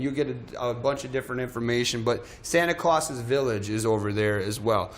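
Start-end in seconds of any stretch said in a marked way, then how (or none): none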